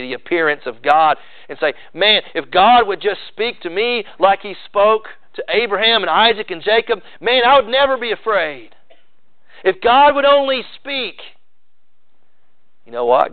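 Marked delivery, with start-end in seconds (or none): 1.9-11.2 higher-pitched wishful plea, building higher; acted longing. Preacher voicing people who wish God spoke, acted longing. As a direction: voice a believer's yearning, climbing to the last phrase.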